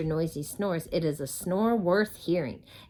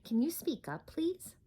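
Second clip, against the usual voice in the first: mock puzzled request; 8 semitones higher, 6 dB quieter than usual. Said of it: "putting her hand to her ear".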